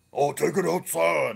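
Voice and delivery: loud, high mocking bellow; mock unwomanly yell. Man mimics the crossdresser in the store, mock yelling. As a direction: imitate an irate outburst, voice pushed up. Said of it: "that's a real womanly voice".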